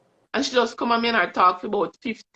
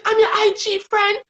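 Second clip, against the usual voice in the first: high, loud and fast, bubbling over; excited fan; 12 semitones higher, 5 dB louder, faster than usual. Retold or imitated. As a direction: gush like a thrilled fan meeting her idol. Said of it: "she's just so excited".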